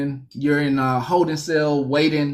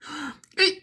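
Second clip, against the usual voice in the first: very high hammed-up sobbing wail; mock crying; faster than usual. Newcomer at the cell door, hammed-up high sobbing. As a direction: wail it like a blubbering mock cry. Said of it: "And you're there crying".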